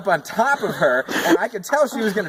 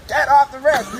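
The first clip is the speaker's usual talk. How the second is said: sharp raised yell, higher and louder; yelling at the cat; 7 semitones higher, 5 dB louder than usual. Retold or imitated. Shaking the branch, yelling at the cat to get off. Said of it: "was like".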